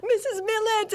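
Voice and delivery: high, mock-panicked call of her name; scared cry. Chauffeur cries out to her as she wrecks the garage, mock panic. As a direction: imitate a frightened chauffeur yelping. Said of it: "all scared for his life".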